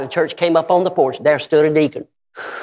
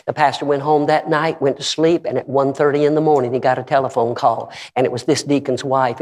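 normal talking voice

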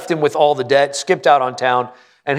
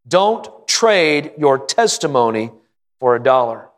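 slower repeat; 2 semitones lower, slower than usual. Acted.

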